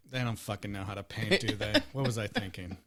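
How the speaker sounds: low, very soft imagined inner grumble; dejected mutter. Retold or imitated. Voicing Gus's look at the painters, low dejected mutter. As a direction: mutter it to yourself, defeated. Voices his sad look as thoughts.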